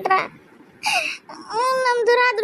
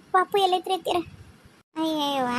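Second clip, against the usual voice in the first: ordinary talk, nothing marked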